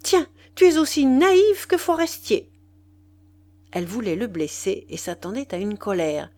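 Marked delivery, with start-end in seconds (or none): 0.0-2.5 high, loud, stinging retort; irritated jab. Acted. Wife trying to hurt Duroy, irritated and loud. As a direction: aim it to wound him. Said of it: "Elle s'irritait".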